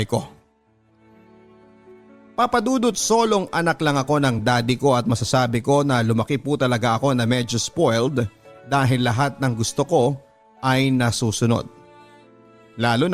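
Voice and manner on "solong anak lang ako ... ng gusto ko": normal talking voice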